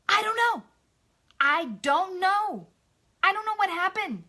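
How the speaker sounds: loud, high, exasperated retort; utter disgust. Retold or imitated. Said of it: "in just utter disgust".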